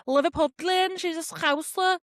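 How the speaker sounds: high, put-on Scouse accent; hammed-up Liverpool twang, badly faked. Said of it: "that was a really bad Scouse accent".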